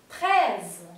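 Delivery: "Treize" with a slightly open E and a buzzing final Z. Shown as a demonstration. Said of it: "se pronuncia como una E. Un poquito abierta".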